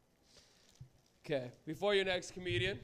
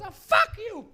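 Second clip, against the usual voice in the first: high, loud parting shout; hammed-up outraged yell; furious old lady; 13 semitones higher, 11 dB louder, much slower than usual. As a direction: scream it as an angry old woman. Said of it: "She goes".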